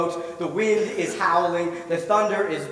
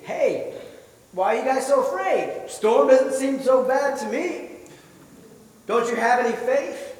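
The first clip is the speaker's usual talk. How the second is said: higher, teasing, smirking tone; 6 semitones higher than usual. Pretend. Jesus teasing scared disciples after storm, smirking. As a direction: gently tease frightened friends with a grin. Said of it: "Jesus said this with a smirk".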